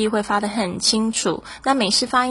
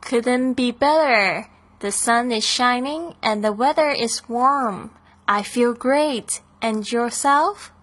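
slow, clear line reading for learners; teacher reading Lily's part to students, slow and clear; slow dialogue read; 3 semitones higher, slower than usual. Acted. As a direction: read Lily's lines slowly and evenly. Plays Lily in slow dialogue.

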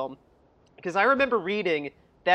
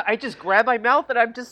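normal talking voice

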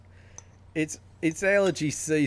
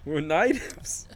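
put-on Australian accent; slower than usual. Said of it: "both of your Australian accents".

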